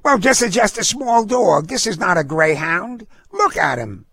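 high-pitched indignant woman; feisty dog owner, protesting. A narrator's reading. Dog owner disputes the fee at the counter, indignant. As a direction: argue back in a high, feisty voice. Voices Missus Harrigan protesting.

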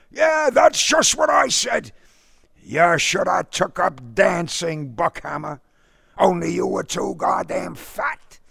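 high, loud taunting shouts; bitter shouted rant. Acted. Father bitterly shouts at the suitor before slamming door. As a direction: lash out at the fat suitor.

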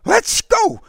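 put-on loud, high boyish yell; shouted. A narrator's reading. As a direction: shout it high, urging a friend to flee. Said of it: "Derek shouted".

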